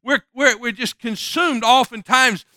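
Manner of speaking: preaching climax building; building sermon fervor. Acted. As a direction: stack the worries up as you preach.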